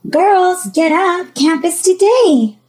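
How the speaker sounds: high, loud mother's wake-up call; yelling out. Acted. Mother waking girls for camp, yelling out. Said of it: "A mother yelled out".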